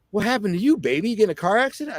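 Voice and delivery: lightly voiced woman, pitch lifting on the questions; concerned woman, imitated. Woman asking a bloodied stranger what happened, lightly imitated concern.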